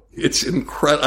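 ordinary talk

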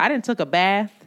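ordinary delivery

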